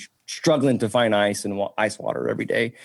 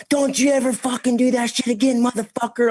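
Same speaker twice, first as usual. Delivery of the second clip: high shrill-free mock yell of the daughter, much higher; mock angry daughter; 13 semitones higher than usual. Daughter cussing him out over the ice machine, mocked high.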